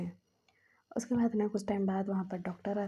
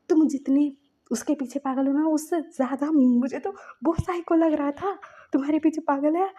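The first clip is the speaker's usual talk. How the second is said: higher and louder, frantic; desperate pleading; 6 semitones higher, 8 dB louder than usual. Acted. Myamya begs Lajan to believe her: high, loud, frantic plea. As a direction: push the words out high and pleading.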